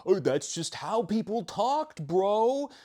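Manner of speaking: high, drawn-out dismissive bro; hammed-up bro shrugging off criticism; mocking bro voice. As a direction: sneer it like a dudebro brushing it off. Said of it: "there's an argument to be made where it's like".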